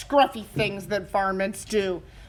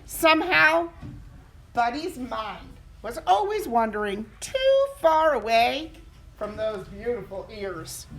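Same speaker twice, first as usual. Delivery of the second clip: plain reading voice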